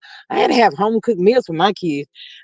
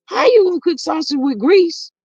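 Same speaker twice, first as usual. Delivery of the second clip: high, exasperated scold; pitched-up exasperated rant; 11 semitones higher than usual. Real, not acted. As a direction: chew him out in disbelief. Scolding son's cooking mishap.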